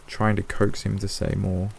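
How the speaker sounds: ordinary delivery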